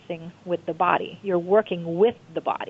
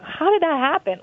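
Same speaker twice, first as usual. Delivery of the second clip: much higher, disbelieving question; amazed question; 8 semitones higher than usual. Retold or imitated. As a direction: ask it like you can't believe what you saw. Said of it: "You're like".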